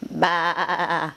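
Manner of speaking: wavering, drawn-out baa; quavering sheep sound; sheep bleat. Shown as a demonstration. Parent imitates sheep for child.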